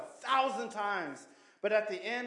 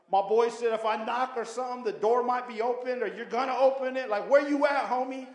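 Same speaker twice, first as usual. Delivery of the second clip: loud, raised calling out to God; 4 semitones higher, 7 dB louder than usual. Retold or imitated. Prisoner calling out loud to God from his cell. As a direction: call out as if God is far off.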